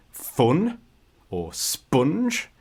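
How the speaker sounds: "fun" and "sponge" with a northern English "uh", as in foot; northern vowel. Shown as a demonstration. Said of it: "we say "uh".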